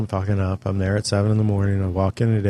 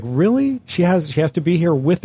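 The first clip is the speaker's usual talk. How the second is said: incredulous; 7 semitones higher than usual. Retold or imitated. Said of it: "And I was like".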